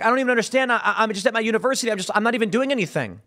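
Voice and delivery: high, hurried student protest; mock bewildered protest. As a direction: imitate a confused kid pleading innocence, words tumbling out. Mimics bewildered arrested college students.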